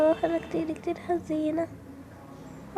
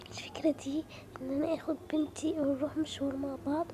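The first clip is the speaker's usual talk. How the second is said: whisper; 4 semitones lower, 10 dB quieter than usual. Acted. Mother whispering her secret plan.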